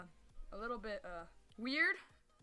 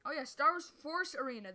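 ordinary talk, nothing marked

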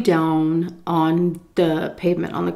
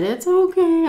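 high mock-tearful reassurance; high-pitched pretend crying voice; imitated crying; 11 semitones higher than usual. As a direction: act out weepy forgiveness. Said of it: "I was crying".

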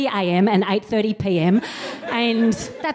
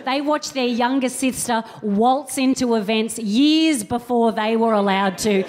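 ordinary talk, nothing marked

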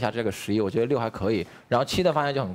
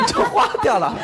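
laughing; 12 semitones higher, 6 dB louder than usual. Real, not acted. Joking about the tattoo.

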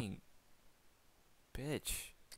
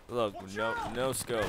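normal talking voice

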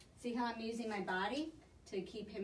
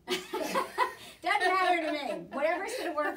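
laughing; 8 semitones higher, 11 dB louder, slower than usual. Real, not acted. Laughs while answering.

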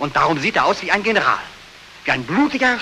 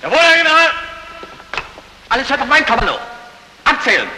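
shouted commands; 5 semitones higher than usual. Acted. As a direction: yell orders across the parade ground. Soldier shouting commands in dream.

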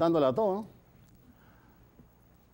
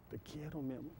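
much softer, low, intimate murmur; lover's whisper; 4 semitones lower, 18 dB quieter than usual. Acted. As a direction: breathe it across the table. (la novia susurra al amante, muy bajito)

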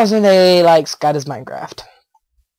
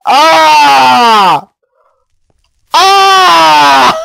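loud high shout of shock; real startled scream; 9 semitones higher, 6 dB louder than usual. Real, not acted. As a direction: scream as something jumps you. Startled in game.

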